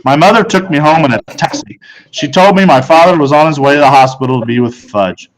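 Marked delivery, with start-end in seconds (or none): none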